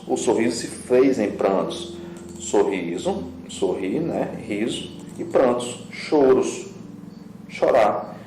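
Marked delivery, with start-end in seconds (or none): none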